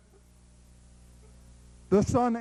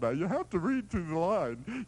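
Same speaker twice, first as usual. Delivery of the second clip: ordinary delivery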